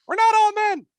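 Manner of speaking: very high, tiny squeaking protest; a small mouse squeaking to her leader; squeaky mouse. Acted. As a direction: squeak out an indignant correction. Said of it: "one of the mice squeaks".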